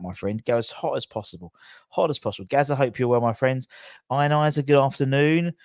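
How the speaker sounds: usual speaking voice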